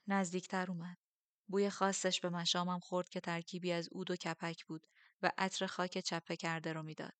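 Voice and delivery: plain reading voice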